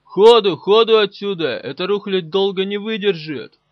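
urgent high, loud shouting; frantic full-voiced shout to the crew; yelling. A narrator's reading. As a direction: bellow orders to get out under fire. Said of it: "заорал Каблуков".

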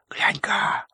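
hushed unvoiced whisper. A narrator's reading. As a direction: whisper it to a neighbour. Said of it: "В толпе зашушукались".

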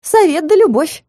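high, ringing congratulation; said out loud. A narrator's reading. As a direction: announce it brightly for all to hear. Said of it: "уже громко сказала".

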